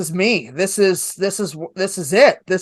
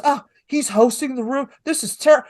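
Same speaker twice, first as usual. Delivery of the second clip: higher-pitched imitation of someone panicking; mock panic; 5 semitones higher than usual. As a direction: play his fretting, cut off mid-word. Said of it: "he was, like, panicking".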